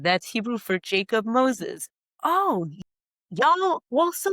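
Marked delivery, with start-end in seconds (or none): 2.2-4.3 mock Southern drawl, pitched up; playful imitation of a Southern neighbor's accent. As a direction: put on a high Southern belle accent. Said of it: "I'm so good at the accent".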